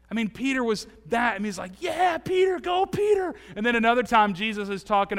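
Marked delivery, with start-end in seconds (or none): 1.8-3.3 high excited shout; cheering. Pretend. Jesus cheering on Peter for his answer, high excited shout. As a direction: cheer him on like a fan.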